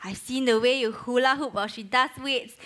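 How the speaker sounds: usual speaking voice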